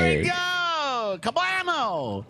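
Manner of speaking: high slow gloating cry; triumphant cheer. Real, not acted. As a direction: drag out the victory whoop. Triumphant in game.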